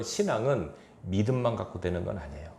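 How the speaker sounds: ordinary delivery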